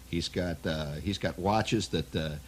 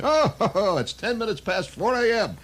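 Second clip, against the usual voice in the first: much higher, chuckling sing-song; mock cheerful victim; 12 semitones higher, 4 dB louder than usual. Victim cheerfully reads the time to the mugger, chuckling. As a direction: play the innocent while springing the trap.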